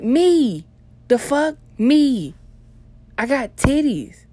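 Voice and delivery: loud, high-pitched indignant comeback; pissed. Retold or imitated. Telling the jealous girlfriend the bra is hers, pissed. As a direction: re-enact an outraged protest, punching it high and loud. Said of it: "I had every right to be pissed".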